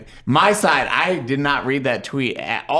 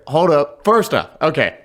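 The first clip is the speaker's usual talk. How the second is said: loud, higher retort; indignant pushback; 5 semitones higher, 4 dB louder than usual. Retold or imitated. Him objecting to the bosses on a call, indignant pushback. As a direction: cut in to object, raised voice. Acted pushback in retold argument.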